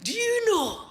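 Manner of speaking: put-on high, drawn-out Scottish accent; impersonation of a Scottish man. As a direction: do an earnest Scottish drinker with stretched, swooping words. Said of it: "this is how George sounded".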